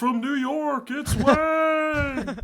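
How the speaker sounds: loud, high, stretched-out live TV opener; show-opening shout. Pretend. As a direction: holler it like opening a comedy show.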